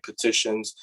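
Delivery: usual speaking voice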